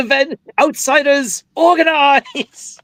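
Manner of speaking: high, stretched mock call to arms; hammed-up rally, high and drawn out; mock rallying cry. Mimicking a rallying movie line.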